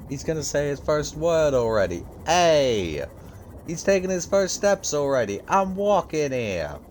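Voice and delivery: put-on half-joking Italian accent quoting a TikTok; mock Italian accent. Gamer imitating a funny TikTok in mock Italian accent. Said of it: "talking about it in an Italian accent".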